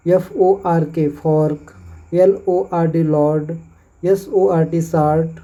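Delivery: slow teacher demo of fork; slow spelling demo. Shown as a demonstration. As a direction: spell out slowly, then fork with 'aur'. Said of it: "इसका उच्चारण और से होता है". (धीमे स्वर में फॉर्क)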